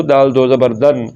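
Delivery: ordinary delivery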